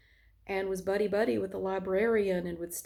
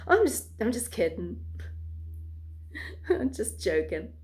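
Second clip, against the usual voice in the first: mock joking. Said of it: "he thought that made it okay".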